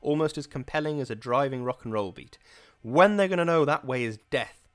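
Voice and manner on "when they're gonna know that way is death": loud, high, hammering rhythm that keeps climbing; powerful pumping cadence. Acted. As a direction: drive each no room like a rock beat. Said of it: "a powerful, pumping cadence".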